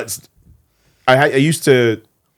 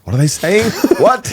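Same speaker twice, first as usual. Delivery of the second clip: higher, rising baffled question; mock bafflement; 7 semitones higher, 3 dB louder than usual. Baffled visitor to the next village, higher. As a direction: ask it with pitched-up disbelief.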